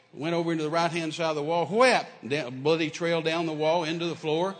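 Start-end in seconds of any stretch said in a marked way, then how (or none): 1.7-2.1 sharp loud impact noise; loud whap. Retold or imitated. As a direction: smack the sound out. Bat hits the wall.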